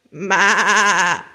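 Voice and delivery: quavering goat bleat; long, wobbling maa; goat's bleat. A narrator's reading. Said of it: "said a goat".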